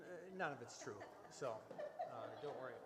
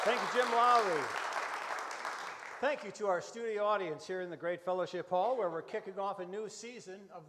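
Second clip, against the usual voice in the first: much louder, beaming host welcome to the hall; radio host greeting; 3 semitones higher, 8 dB louder than usual. Acted. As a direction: project it to the studio audience. Host greets the studio audience.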